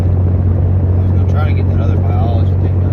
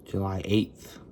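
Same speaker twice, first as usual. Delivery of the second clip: numb-mouthed slight lisp; numb face lisp; 3 semitones lower, 13 dB quieter than usual. Real, not acted. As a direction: talk with half the face numb. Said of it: "it sounds like I have a lisp".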